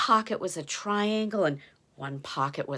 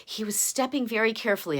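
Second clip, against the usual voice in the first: plain reading voice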